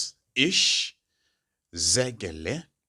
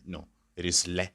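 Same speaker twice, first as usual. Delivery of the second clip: ordinary talk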